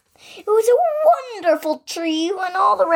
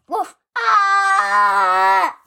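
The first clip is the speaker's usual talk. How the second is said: louder high shout; yell; 6 semitones higher, 4 dB louder than usual. Acted. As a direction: cry out in alarm. Tree racket ends in yell.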